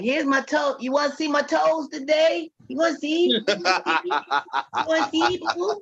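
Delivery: high, coy, teasing toe offer; mock flirty voice offering toes; playful teasing offer.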